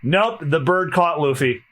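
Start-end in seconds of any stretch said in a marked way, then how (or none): none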